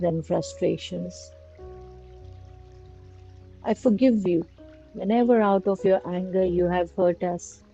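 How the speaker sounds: usual speaking voice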